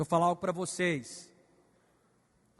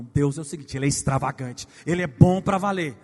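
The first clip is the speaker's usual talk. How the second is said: ordinary talk, nothing marked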